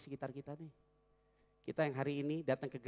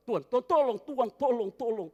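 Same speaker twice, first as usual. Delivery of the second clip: loud, high mock wailing for help; over-the-top plea; 10 semitones higher, 15 dB louder than usual. Pastor mocking a too-dramatic prayer on the escalator, loud. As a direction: ham up a desperate prayer. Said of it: "Nggak boleh begitu juga".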